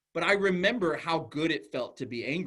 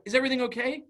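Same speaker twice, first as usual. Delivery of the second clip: alarmed teacher; 10 semitones higher, 5 dB louder, faster than usual. Retold or imitated. Teacher running over after scream.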